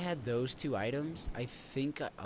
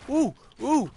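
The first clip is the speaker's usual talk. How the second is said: sharp, loud, high ooh ooh as the shot lands; excited ooh; 9 semitones higher, 9 dB louder than usual. Real, not acted. Gamer yelping at a sudden hit, loud and high.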